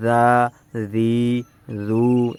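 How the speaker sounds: "দা, রি, রু" dragged out, one long syllable at a time; drawn-out syllables. Shown as a demonstration. Teacher shows students what not to do, drawn-out syllables. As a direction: stretch "দা, রি, রু" with long held vowels. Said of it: "এভাবে টেনে টেনে করবেন না".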